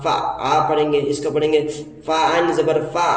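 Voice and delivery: ordinary talk, nothing marked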